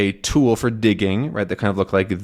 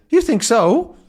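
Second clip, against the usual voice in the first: high, louder puzzled question; a deaf old man who misheard; lightly voiced deaf professor asking; mishearing professor; 12 semitones higher, 5 dB louder than usual. Deaf Calculus misheard reply.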